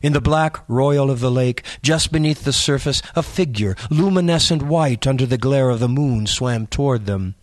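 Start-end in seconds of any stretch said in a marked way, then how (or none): none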